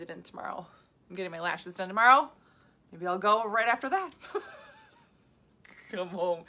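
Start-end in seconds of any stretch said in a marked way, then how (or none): 2.9-6.4 giggly, half laughing through the words. Real, not acted. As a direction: laugh while plotting the surprise. Giggling at her dog plan.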